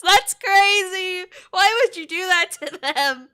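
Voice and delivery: loud, high-pitched protest; scandalized outcry; appalled disbelief. Real, not acted. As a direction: cry out, aghast at the suggestion. Appalled at chat's cruel anime recommendation.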